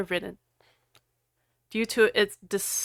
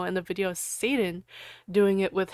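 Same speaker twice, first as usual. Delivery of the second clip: ordinary delivery, nothing marked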